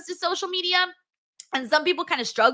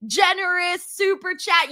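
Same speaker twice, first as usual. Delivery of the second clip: ordinary delivery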